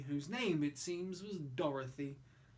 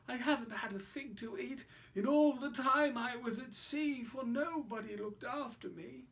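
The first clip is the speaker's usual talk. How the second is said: starving anteater; 5 semitones higher than usual. Acted. Starving anteater begging the boy for food, pleading. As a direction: beg weakly for a crust. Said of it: "and softly said".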